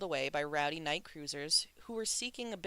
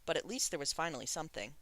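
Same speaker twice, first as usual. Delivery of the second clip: usual speaking voice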